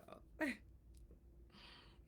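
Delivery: breathy laugh cut short by pain. Real, not acted. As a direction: laugh through soreness. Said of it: "It hurts to laugh".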